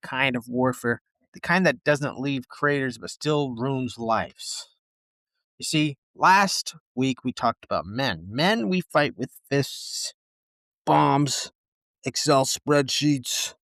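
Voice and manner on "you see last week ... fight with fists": normal talking voice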